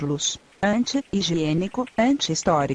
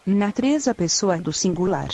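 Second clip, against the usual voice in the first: ordinary talk, nothing marked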